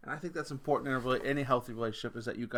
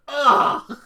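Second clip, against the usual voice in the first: mock disgusted groan; 12 semitones higher, 15 dB louder than usual. Teens groan at mom's joke.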